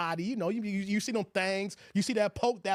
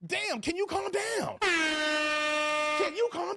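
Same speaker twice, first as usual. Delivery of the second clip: exasperated plea; 8 semitones higher, much slower than usual. Retold or imitated. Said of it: "part of you can be like".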